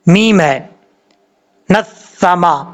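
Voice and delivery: usual speaking voice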